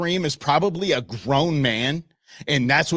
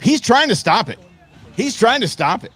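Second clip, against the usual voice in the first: loud, raised, excited repeat; incredulous exclamation; 7 semitones higher, 7 dB louder than usual. Real, not acted. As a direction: exclaim at the hopeless attempt. Exclaiming at the clip.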